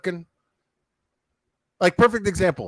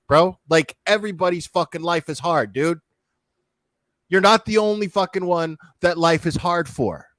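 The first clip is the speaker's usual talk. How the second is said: ordinary delivery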